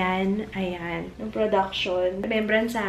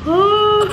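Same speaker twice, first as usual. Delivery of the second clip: loud, high-pitched yawn; 13 semitones higher, 8 dB louder than usual. Real, not acted. Sleepy vlogger after waking early, loud yawn. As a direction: let out a big yawn. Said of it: "hihikab ko".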